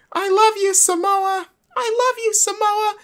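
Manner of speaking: high-pitched fan squeal, imitating a kid at the barricade; a kid fan screams love at the wrestler, high mock squeal; screaming kid fan. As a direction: shriek it like a kid fan on the rail. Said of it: "one of them keeps screaming".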